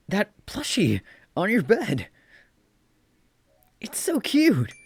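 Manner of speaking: very high, loud gushing squeal; squealing cute. Acted. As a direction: gush over it in a high squeal. Character squeals over plushie.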